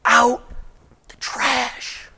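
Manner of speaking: quiet command. Retold or imitated. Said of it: "He says".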